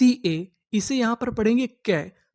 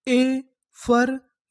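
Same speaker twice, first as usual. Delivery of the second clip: Effervescence broken into syllables, very slow and higher; slow demo; 4 semitones higher, much slower than usual. Shown as a demonstration. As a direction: spell out effervescence slowly for the class. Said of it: "तो हमारी पूरी meaning क्या बनी?". (Effervescence धीरे-धीरे टुकड़ों में)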